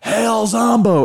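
drawn-out, high mock holler; retold shout. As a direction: imitate calling out of a car window, slowly. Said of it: "I just shouted".

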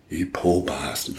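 a hushed private thought to the newborn; murmured pity, held back; quiet aside. Retold or imitated. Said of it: "and I went".

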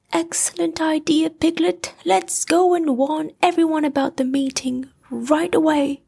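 high, quick, pleased bear; bright and hurried, high up; Pooh voice. A narrator's reading. Pooh praising Piglet's idea, high and quick.

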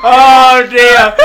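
loud, high laughing cry; cracking up out loud; helpless laughter. Real, not acted. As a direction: exclaim through a real fit of laughter. Helpless laughter at botched meme.

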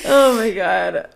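laughing to tears; helpless teary laughter; crying laughter. Real, not acted.

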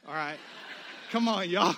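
usual speaking voice

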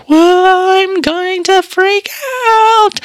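high, slow, strained panic with a crying edge; panicky. Retold or imitated. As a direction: play the rising panic as it hits. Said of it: "I was sitting there like".

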